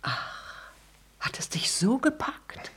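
soft breathy dismay; woman whispering her hurt to her lover. Acted. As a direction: whisper it wounded. Arkadina reacts, hurt.

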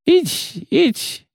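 choking, clutching chest, squeaking high; gasping panicked old clerk; having a heart attack. Acted. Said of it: "захлебнулся, хватаясь за грудь".

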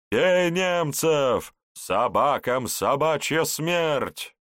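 slow, loud rallying yell; shouting crowd cry. A narrator's reading. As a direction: bellow it like a rioting youth. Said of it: "С криком и воплями".

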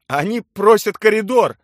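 high, very loud relay; loud, alarmed interpreter; urgent shout. A narrator's reading. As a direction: shout the message across urgently.

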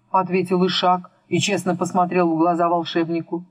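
usual speaking voice

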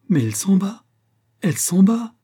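high, loud cry of disbelief; choked cry. A narrator's reading. Astronomer watching the moon slip away, choked alarmed cry. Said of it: "s'écria-t-il d'une voix étranglée".

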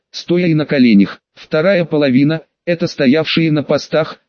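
ordinary delivery

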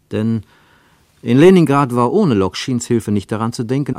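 usual speaking voice

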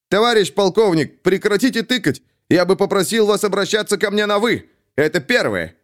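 agitated, voice pushed up; visibly nervous. A narrator's reading. Captain protesting to his colonel, nervous and agitated. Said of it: "заметно волнуясь".

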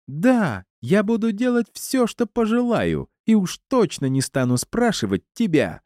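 very high-pitched, defiant squeal; high, indignant screech. A narrator's reading. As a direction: screech it high without much extra volume. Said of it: "заверещала Елена".

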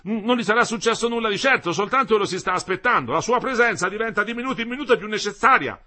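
high, loud, anxious visitor; agitated young man. A narrator's reading. Young Renzoni tells Ersilia that Metello is awaited, agitated. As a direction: speak up high, pressing the urgency.

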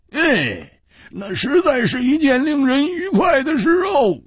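wistful sigh, then rising toward home; with a long sigh. A narrator's reading. Lonely swordsman after wine, sighing wistfully. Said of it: "长叹了一声说".

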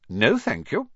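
high, frosty refusal; cold. A narrator's reading. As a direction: decline coldly and primly. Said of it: "said Professor McGonagall coldly".

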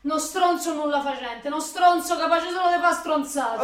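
urlando forte, più acuta; insulti furiosi. Acted. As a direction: gli sputa addosso gli insulti. Lei insulta lui urlando. (lei urla a lui insulti furiosi)

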